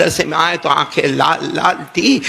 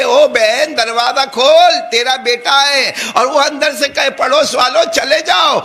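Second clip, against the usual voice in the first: neighbours shouting to open the door; پڑوسیوں کی پکار; calling out; 6 semitones higher than usual. Retold or imitated.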